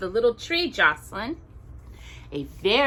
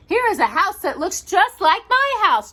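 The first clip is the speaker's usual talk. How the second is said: much higher, louder little bear; 12 semitones higher, 7 dB louder than usual. Acted. Little Bear finds a house on the moon, excited high voice. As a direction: squeal it like an excited small bear.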